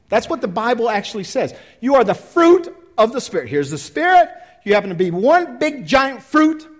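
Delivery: high, loud preaching; fervent pulpit build. Acted. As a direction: drive home the point to the crowd.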